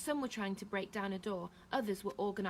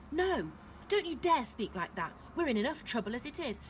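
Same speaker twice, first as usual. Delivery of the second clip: louder, higher scolding; frightened rebuke; 5 semitones higher, 4 dB louder than usual. A narrator's reading. Terrified woman to Neil, raised-voice rebuke. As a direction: cut him off sharply.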